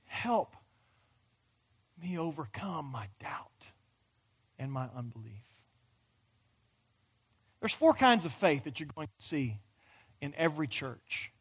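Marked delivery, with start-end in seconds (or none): 0.0-5.4 breathy whisper, much softer; whispered prayer. Pretend. A doubter quietly begs God, whispered. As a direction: drop to a broken whisper.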